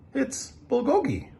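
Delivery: put-on a friendly kid naming his food; young boy. New boy Justin explaining food.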